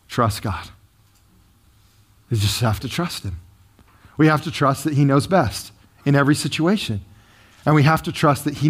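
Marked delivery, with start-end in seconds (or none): 0.1-3.4 quiet. Real, not acted. Sermon point, dropped low.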